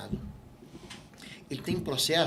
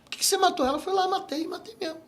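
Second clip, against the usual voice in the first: callous psychopath; 4 dB louder than usual. Retold or imitated. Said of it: "Ele entende a letra e fala assim".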